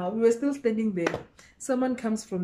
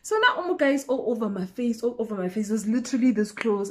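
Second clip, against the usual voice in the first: ordinary talk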